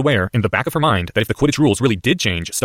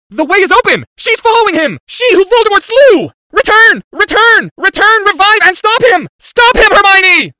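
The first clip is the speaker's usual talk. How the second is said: high, screamed incantatory cries; frantic shriek; 10 dB louder, slower than usual. Acted. Boy calling Hermione to return, frantic shriek. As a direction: scream it out, building to a plea.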